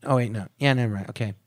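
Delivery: rushed, tumbling false starts; fast mumble that gives up at the end; slurred fast talk. Shown as a demonstration. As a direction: blurt half-thoughts and slur them together. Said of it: "I would slur my words and talk fast".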